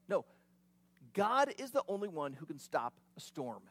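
usual speaking voice

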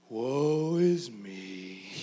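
slow, drooping Eeyore moan; gloomy Eeyore. Retold or imitated. Pastor voicing the gloomy official as Eeyore, slow and mopey. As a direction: drag it out like a sad donkey. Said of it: "living, talking Eeyore".

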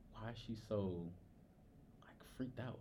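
hushed, breathy inner question; a quiet aside in the head; whispered thought. Retold or imitated. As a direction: whisper it to yourself, puzzled. Inner thought while she panics.

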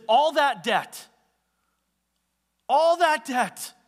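"All that debt" high, loud and slow; emphasis on "All that debt". Acted. Master reminding the servant, "All that debt" loudly emphasized. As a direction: hit "All that debt" hard, stretching it out. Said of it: "It shows the emphasis of what the master says".

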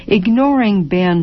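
ordinary delivery, nothing marked

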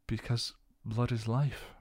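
ordinary delivery

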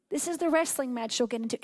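normal talking voice

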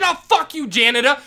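angry yell. Retold or imitated. Vice principal insulting the janitor, angry yell. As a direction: throw the insult right back. Retold shouting match with principal.

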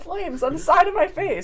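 high, loud movie-quote line; Madeline Kahn quote. Retold or imitated. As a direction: belt out the famous line, lightly. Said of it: "What is that from Murder, She Wrote?".

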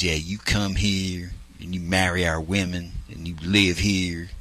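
low, slow, quiet Southern drawl; stretched country drawl, kept low; Jeremy Pruitt impersonation. Retold or imitated. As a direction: imitate a grudging Southern man, soft and deep. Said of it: "you sound like you're impersonating Jeremy Pruitt".

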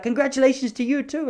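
put-on high, cheeky old man voice; proud stallholder. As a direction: toss it back with pride.

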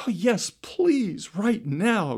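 high, slow and eager; eager excited. Acted. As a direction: plead eagerly, voice lifted high. Grandpa eager to cross.

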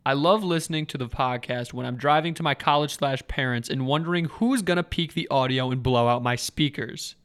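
ordinary delivery

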